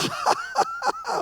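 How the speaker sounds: very high-pitched cackle; hammed-up shrieking laugh; imitated laughter. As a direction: keep cackling helplessly in high bursts. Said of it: "막 계속 웃었대요".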